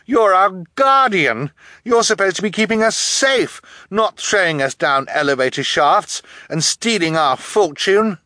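high, loud, indignant protest; crying out. A narrator's reading. Orphan to treacherous guardian, crying out in protest. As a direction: raise your voice in outraged appeal. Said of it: "Violet cried".

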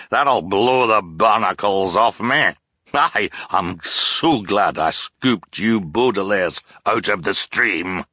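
high, loud gloating with a hearty laugh; captain crowing over chowder and the sugar bowl; gleeful blustering captain. A narrator's reading. Captain gloating to the Baudelaires over dinner, laughing loudly.

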